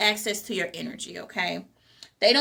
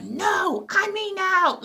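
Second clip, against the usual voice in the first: a much higher mock angry brush-off; 9 semitones higher, slower than usual. Speaker mimics an angry refusal to family, mock angry. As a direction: play the upset person who refuses outright. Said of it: "get upset and be angry and just be like".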